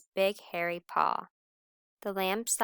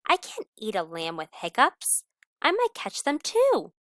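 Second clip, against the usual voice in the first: put-on old wolf; 4 semitones higher, 6 dB louder than usual. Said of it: "said the old wolf".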